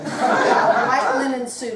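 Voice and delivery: words broken by real laughter; laughing while telling. Real, not acted. Laughing while recalling the moment.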